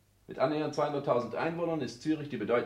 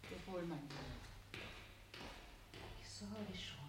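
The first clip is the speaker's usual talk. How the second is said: distant; 6 semitones higher, 21 dB quieter, much slower than usual. Acted. Voices fade as if off in a dream.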